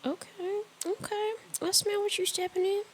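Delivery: high-pitched agreement. Real, not acted. High, impressed response.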